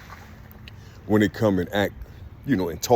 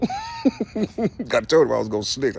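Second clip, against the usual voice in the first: laughing; 8 semitones higher, slower than usual. Retold or imitated. Laughing retelling his threat.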